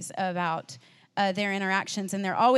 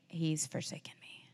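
breathy whisper, barely voiced; a hushed, broken admission; despairing whisper; 4 semitones lower, 11 dB quieter than usual. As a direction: let the despair slip out as a whisper. Inner feeling voiced as quote.

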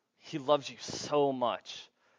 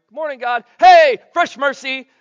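high, loud, stretched hello to God; louder excited greeting; 12 semitones higher, 20 dB louder than usual. Pretend. Preacher repeating the morning greeting to God, louder and giddier. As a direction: throw the morning hello up to the rafters.

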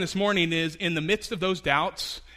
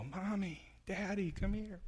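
soft, small voice of a toddler after a bad dream; a scared toddler waking up at night, quiet; whimpering child; 4 semitones higher, 10 dB quieter than usual. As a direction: call softly for the parents, half asleep. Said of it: "you hear that whimpering cry".

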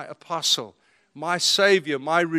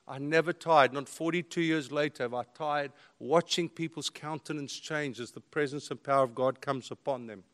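usual speaking voice

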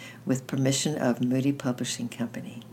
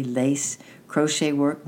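plain reading voice

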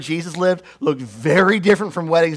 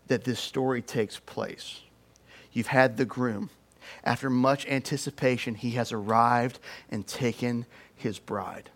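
normal talking voice

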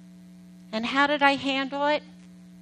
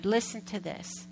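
usual speaking voice

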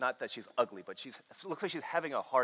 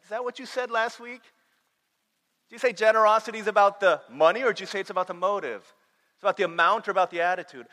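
challenging God voice; 10 semitones higher, 12 dB louder than usual. Retold or imitated. God challenging him, retold.

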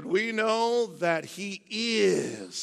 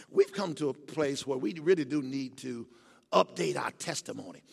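normal talking voice

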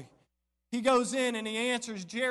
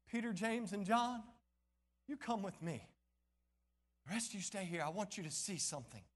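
quiet instruction; 8 dB quieter than usual. Acted. Lord quietly picks three disciples.

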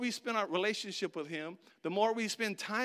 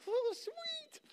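much higher squeaky glee; secretly delighted; 5 dB quieter than usual. Retold or imitated. Benchwarmer glad a teammate got hurt, squeaking with glee. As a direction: sneak a happy little squeal. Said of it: "I'm like".

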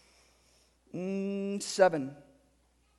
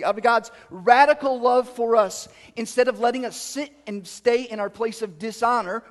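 louder, rising preaching push on God's riches; forceful preaching; 5 semitones higher, 9 dB louder than usual. Acted. Pastor proclaiming God's mercy to the church, full-force preaching. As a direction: build it up and proclaim it.

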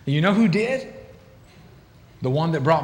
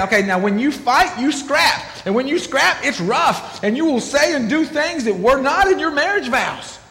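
higher, animated counselor talking a couple through their fights; 7 semitones higher than usual. Retold or imitated. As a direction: mimic a counselor laying out how the couple fights. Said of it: "we would start talking to us and saying".

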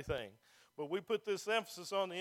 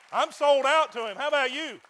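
loud, higher, fervent declaration; preaching at full force; 6 semitones higher, 15 dB louder than usual. Acted. Pastor challenging the church, loud fervent declaration. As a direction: shout your loyalty to the room.

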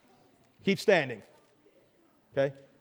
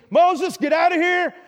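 Pharaoh snapping at Moses, louder; angry Pharaoh; 7 semitones higher, 5 dB louder than usual. Pretend. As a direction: bark the order out in rage. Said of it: "Pharaoh is so mad".

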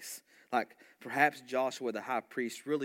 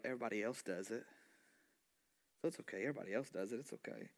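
soft, hushed devil's coaxing; tempting hush; 7 dB quieter, much faster than usual. Retold or imitated. Preacher voicing the devil's lure to the congregation, hushed and coaxing. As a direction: lean in and lure them quietly.

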